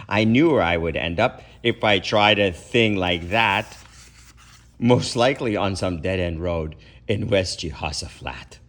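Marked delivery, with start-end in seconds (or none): none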